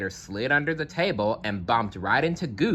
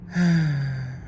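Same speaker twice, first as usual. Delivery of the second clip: a long, heavy sigh; 6 semitones higher than usual. A narrator's reading. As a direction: let out a big tired sigh. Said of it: "He heaved a heavy sigh".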